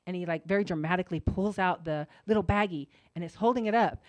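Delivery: normal talking voice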